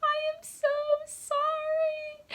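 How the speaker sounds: tiny, very high mock-guilty voice; comically high-pitched confession; squeaky sheepish apology. Sheepish apology to panicked guys.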